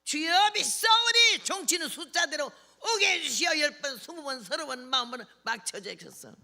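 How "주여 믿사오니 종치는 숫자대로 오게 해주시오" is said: desperate prayer cry. Retold or imitated. Evangelist pleading to God while ringing the church bell, desperate loud cry. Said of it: "열한 시 예배인데 열 시 반 됐는데도 안 오니까".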